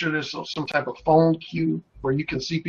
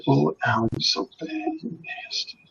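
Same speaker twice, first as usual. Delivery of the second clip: flirty caller; 7 dB quieter than usual. Retold or imitated. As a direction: imitate a flirty woman's hushed, naughty voice. Voices flirty female caller.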